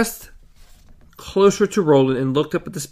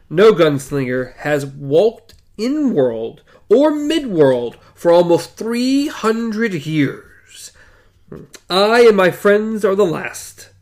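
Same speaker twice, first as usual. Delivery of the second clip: slow, raised, ringing declaration; stately and drawn out, pitched up; grand proclamation; 4 semitones higher, 4 dB louder, slower than usual. A narrator's reading. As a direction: declare it solemnly, stretching the words. Roland proclaims to the voice.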